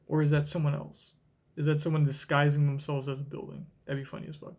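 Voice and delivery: ordinary talk, nothing marked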